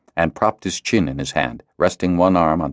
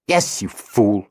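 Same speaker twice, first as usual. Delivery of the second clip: snapping; 4 semitones higher, slower than usual. A narrator's reading. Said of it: "Cedar snapped back".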